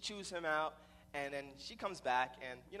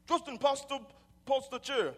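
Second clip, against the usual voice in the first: put-on loud, raised Jamaican-accented command; stepfather's strong voice; 10 semitones higher, 9 dB louder than usual. Jamaican stepfather asking for a chair, loud mock-patois command. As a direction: order it like a forceful Jamaican stepdad. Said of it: "his voice is so strong and so deep".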